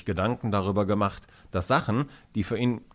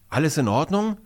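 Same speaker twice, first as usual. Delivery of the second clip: mildly concerned question, pitched up; higher-pitched check that someone is okay; worried; 7 semitones higher, 4 dB louder than usual. A narrator's reading. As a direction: ask with a touch of worry. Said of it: "fragt Pamela besorgt".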